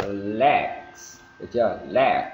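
"legs" with a distinct hissed S ending; drawn-out "legs", clear final hiss; sounded final S. Shown as a demonstration. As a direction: let the S at the end of "legs" sustain. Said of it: "là một âm S thì chúng ta phải có".

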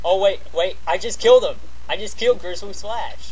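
high, loud triumphant yell; excited shout. Real, not acted. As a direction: celebrate the kill out loud. Boss finally killed.

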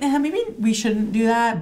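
high-pitched reluctant hedging; acting out a squeamish, high-voiced no; hesitant refusal. Stylist turning down a client's request, acted hesitance. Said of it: "I would probably be like".

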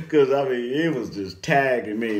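ordinary delivery

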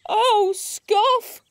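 high-pitched little wail; distressed cry. A narrator's reading. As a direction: wail to a friend for help. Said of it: "cried Dewi".

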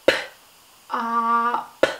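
"P, ah, p" each sound held apart; stretched sounds. Shown as a demonstration. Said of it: "Stretch it with me".

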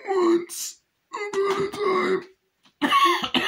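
drawn-out, very high eerie "time"; creepy storyteller. Retold or imitated. A film reviewer mimics the eerie storyteller, high and slow. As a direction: stretch it out like a spooky tale.

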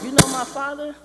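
high, loud disbelief; astonished question. Retold or imitated. Simba hears of his father, loud and astonished. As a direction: blurt it out, startled and loud.

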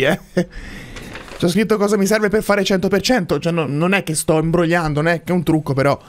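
ordinary talk

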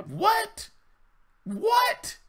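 high squeaked "what?"; very high, incredulous questioning yelp; mock disbelief. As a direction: act floored, pitch shooting way up. Mock reaction of a real man.

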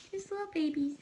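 sweet high pet voice. Real, not acted. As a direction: coo it like talking to a newborn kitten. Cooing at kittens.

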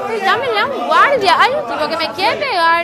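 3 yell for the guard as if about to be hit; 1 panicked shouting. Acted. 4 woman calling for security against man, panicked shout.

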